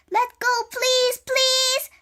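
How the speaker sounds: high, loud pleading shout. A narrator's reading. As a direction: shout a plea at a high, held pitch. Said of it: "Annie shouted at the octopus".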